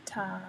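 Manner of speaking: slow, low "Tara" as TAH-ruh; attempted pronunciation. Shown as a demonstration. Trying to pronounce a viewer's name, attempted pronunciation. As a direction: draw out "Tara" softly with an open AH. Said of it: "I'm trying to pronounce somebody's name".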